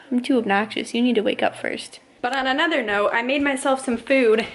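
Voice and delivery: usual speaking voice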